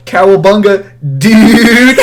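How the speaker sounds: high, loud surfer-style holler; mock exuberant shout. Student re-enacting his outburst in class, exuberant shout. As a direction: holler it out, stretching the last word.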